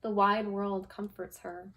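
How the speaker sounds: gentle, unhurried picture-book reading; lightly voiced storytime read; reading to children. Teacher reading a picture book to her class, storytime voice.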